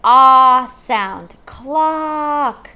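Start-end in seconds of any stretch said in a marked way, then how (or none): 0.0-1.3 loud, drawn-out ah; stressed ah sound. Shown as a demonstration. Teacher to kids at home, stressing ah. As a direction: hit ah hard so kids hear it. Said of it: "I hear the".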